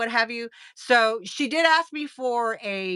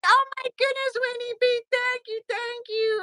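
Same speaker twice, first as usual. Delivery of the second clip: excited; 13 semitones higher than usual. Real, not acted. Super chat from Winnie B.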